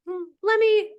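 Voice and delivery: high, curious musing hum; doctor thinking it over. Retold or imitated. Voicing the doctor deciding to dig in, high and curious. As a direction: muse like a doctor about to dig in. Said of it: "which was like".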